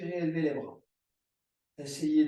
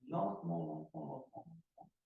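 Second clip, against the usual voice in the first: fading to a hush; 10 semitones lower, 8 dB quieter, faster than usual. Shown as a demonstration. Yoga teacher guiding the class's arms down, fading to a hush. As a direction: lower and much softer, dying away to nothing.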